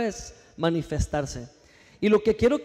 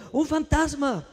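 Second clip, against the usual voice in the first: a mock scared cry, pitched up; frightened cry; 8 semitones higher than usual. A narrator's reading. Disciples at sea cry out, mock fright.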